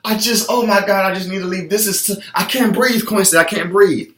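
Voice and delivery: high, strained, out-of-breath panic; panicked gasping complaint. Retold or imitated. Worn-out client gasping to coach Quincy, high panicked whine. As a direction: whine like your lungs are giving out mid-workout. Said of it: "your whole body could be on fire and you're dying".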